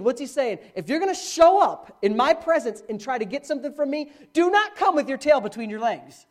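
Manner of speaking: usual speaking voice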